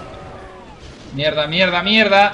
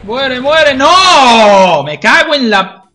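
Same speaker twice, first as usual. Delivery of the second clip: loud yelling at enemies; frustrated yelling; 3 semitones higher, 9 dB louder, much slower than usual. Real, not acted. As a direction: yell it out in frustration.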